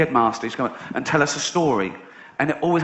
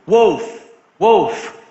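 higher, calling out wolf; hammed-up shout of alarm; boy faking an alarm for the villagers; mock cry of wolf; 7 semitones higher, 4 dB louder than usual. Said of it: "He goes".

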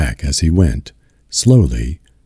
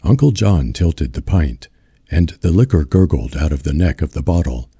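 plain reading voice

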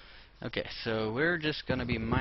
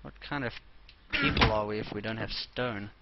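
ordinary talk, nothing marked

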